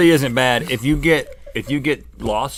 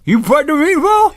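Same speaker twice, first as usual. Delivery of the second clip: shocked; 14 semitones higher, 6 dB louder than usual. Real, not acted. Shocked the other already bit.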